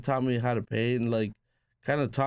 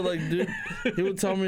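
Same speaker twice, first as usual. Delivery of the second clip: laughing; 9 semitones higher, faster than usual. Real, not acted. Laughing while telling story.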